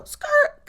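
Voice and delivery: sudden high loud squeal; skirt squeal. Real, not acted. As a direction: let out a gleeful screech. Excited exclamation.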